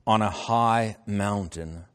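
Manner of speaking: slow, proclaiming Bible reading; stately reading with stretched words; scripture reading. Acted. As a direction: proclaim the verse phrase by phrase. Reads scripture aloud.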